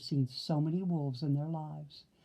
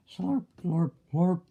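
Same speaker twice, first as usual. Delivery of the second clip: greedy slurping sounds; loud slurping; 5 dB louder than usual. Acted. Winky gobbling creamed corn, slurping. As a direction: slurp it like a hungry pig. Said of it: "slurped Winky".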